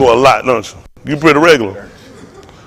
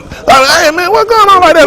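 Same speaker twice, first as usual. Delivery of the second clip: higher, startled hey man; mock startled passer-by; surprised onlooker; 11 semitones higher, slower than usual.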